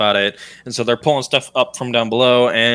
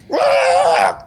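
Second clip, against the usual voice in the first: raised, imitated pig shriek; unholy pig squeal; 5 semitones higher than usual. Halfling reins up the pig, it lets out an unholy squeal. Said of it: "makes an unholy noise".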